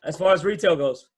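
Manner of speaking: talking through a mouthful of Cheetos; chewing while speaking; mouth full. Real, not acted. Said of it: "You got a mouthful of Cheetos".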